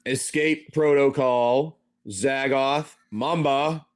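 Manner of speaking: ordinary talk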